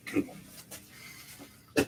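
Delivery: Kill 'em all whispered very slowly; drawn-out voiceless Kill 'em all, mock menace.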